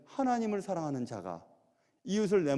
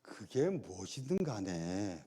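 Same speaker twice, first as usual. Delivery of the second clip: slow, soft unvoiced whisper; a slow, hushed whisper; 10 semitones lower, 8 dB quieter, much slower than usual. Shown as a demonstration. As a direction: breathe the words out slowly. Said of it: "그게 무슨 뜻이냐면".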